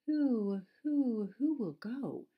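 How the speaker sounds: loud, high, drawn-out owl hoots; questioning owl. A narrator's reading. Owl asking who will go see the stranger, hooting. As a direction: hoot each opening word, falling away. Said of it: "asked the owl of many questions".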